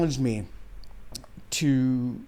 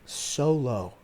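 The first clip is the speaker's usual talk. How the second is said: real tears held back while telling it; tearful; 2 semitones lower, 5 dB quieter than usual. Real, not acted. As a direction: let it fall to a near-whisper, fighting tears. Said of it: "tearing up right now talking about it".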